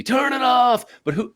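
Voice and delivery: high-pitched imitation of yelling at the TV; retold desperate yell. Retelling the film's ending, imitating a man yelling at the TV. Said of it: "he's yelling at the T V".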